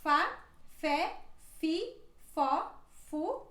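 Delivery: fa fé fi fó fu, each syllable crisp and separate; syllable drill. Shown as a demonstration. Teacher drills the f syllables for learners. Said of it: "ela se pronuncia".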